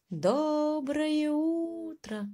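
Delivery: high, slow humming greeting; droning plane. Acted. Airplane booming back to the mouse, slow and drawn. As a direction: drone it like a passing plane. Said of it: "громко прогудел самолёт".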